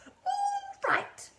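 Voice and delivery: very high, outraged screech; yell. A narrator's reading. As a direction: throw it back at him in a shriek. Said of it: "she yelled".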